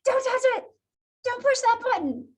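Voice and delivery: high-pitched, playful scolding; mock warning. Teacher playfully acting out a student's gesture, mock warning.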